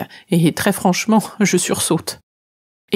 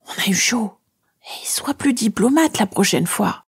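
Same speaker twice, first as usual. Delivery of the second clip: whisper; 4 semitones higher than usual. A narrator's reading. Shadow whispers advice to the girl, whispered. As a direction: whisper it close to her ear. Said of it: "Elle me murmure".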